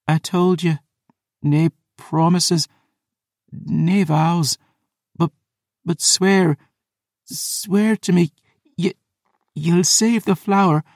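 high, halting Scottish plea; dying Scottish woman. Acted. Dying Phoebe asks for an oath, pained and pleading. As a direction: labour through a last request.

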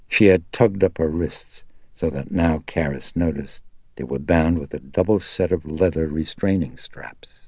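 ordinary delivery

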